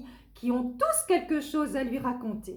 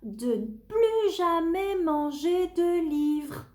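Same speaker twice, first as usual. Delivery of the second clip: pleading; 6 semitones higher than usual. Acted. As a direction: beg the monster gently. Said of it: "supplie Tony".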